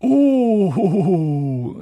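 high, loud gloating "oh-ho-ho"; mischievous hooting chuckle; spiteful cackle. A narrator's reading. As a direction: giggle nastily like a poltergeist. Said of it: "sagte er mit einem gehässigen Kichern".

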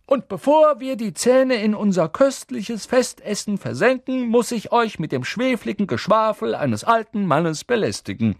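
high, loud festive speech; jovial old headmaster proclaiming; exuberant. A narrator's reading. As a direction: address the whole hall with merry pomp. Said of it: "rief Dumbledore ausgelassen".

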